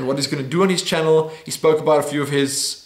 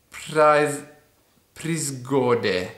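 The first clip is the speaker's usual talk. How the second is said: normal talking voice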